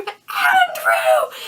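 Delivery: very high, loud scream of his name; dramatic scream. Retold or imitated. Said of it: "I scream".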